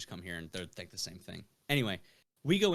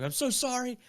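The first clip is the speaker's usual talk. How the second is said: high, whiny pleading; mock apology, pitched way up; 13 semitones higher, 3 dB louder than usual. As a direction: beg the bartenders for forgiveness. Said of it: "pleading with the bartenders".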